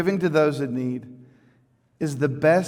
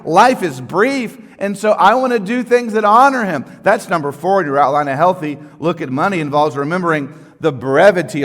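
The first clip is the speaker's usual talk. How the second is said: louder, higher preaching voice, stretching key words; full-force preaching; 4 semitones higher, 8 dB louder than usual. Acted. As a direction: preach it out to the congregation. Preaching with raised voice.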